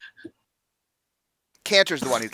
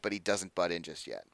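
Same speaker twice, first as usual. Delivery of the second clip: ordinary delivery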